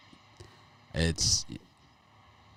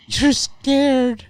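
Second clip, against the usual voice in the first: mocking taunt; 9 dB louder than usual. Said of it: "mocking taunt".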